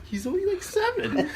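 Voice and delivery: high squeal through laughter; helpless high-pitched giggling; laughing. Real, not acted. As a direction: squeeze the words out while cracking up. Cracking up at own story.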